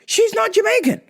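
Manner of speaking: a hint of a stern father, high-pitched; mock dad. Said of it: "he goes".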